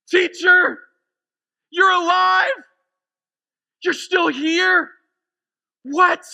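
high, shouted disbelief; astonished cry. Acted. Mary seeing her teacher alive, shouting in amazement. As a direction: shout each phrase as if stunned. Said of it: "cried out".

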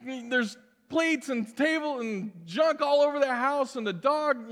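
high, flustered mock delight at the door; flustered fake welcome. Pastor caught at home by a visitor, flustered mock delight. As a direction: gush a fake welcome while panicking about the mess. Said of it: "And you're like".

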